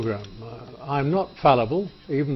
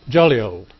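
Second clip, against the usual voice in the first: mock English accent; 3 semitones lower, 6 dB louder than usual. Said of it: "jolly good English accent".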